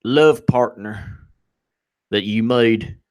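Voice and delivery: slow, drawn-out Southern questioning; Southern congressman. Acted. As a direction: drawl it like a Southern congressman at a hearing. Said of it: "one of the Southern congressmen's like".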